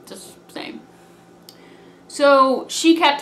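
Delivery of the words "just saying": barely voiced, soft; whispered aside. Real, not acted. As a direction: breathe it out like a secret. Sly aside after fighting brag.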